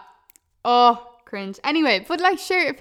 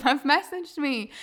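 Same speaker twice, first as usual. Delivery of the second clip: normal talking voice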